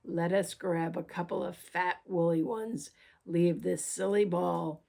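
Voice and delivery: low growl, slowing at the end; growling wolf. Acted. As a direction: tempt her low and hungry. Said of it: "Then he growled in both ears".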